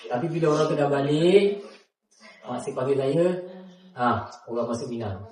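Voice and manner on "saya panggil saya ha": ordinary delivery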